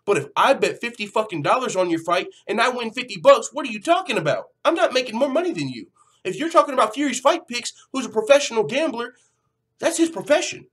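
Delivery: exasperated vlogger ranting at a fighter's tweet; heated rant. Real, not acted. Ranting about betting money claim.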